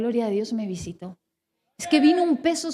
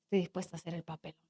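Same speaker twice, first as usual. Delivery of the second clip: quiet surrender; 5 semitones lower, 9 dB quieter than usual. Retold or imitated. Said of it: "Y lo logré decir".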